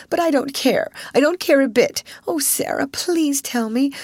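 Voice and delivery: pleading. A narrator's reading. Ermengarde pleading with Sarah in the attic, tearful. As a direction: beg her to explain.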